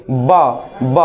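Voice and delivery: ब with the lips pressed shut then opened; ब as a lip burst; b sound. Shown as a demonstration. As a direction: pop the lips apart for ब. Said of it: "ब देखो कैसे बोलते हैं हम".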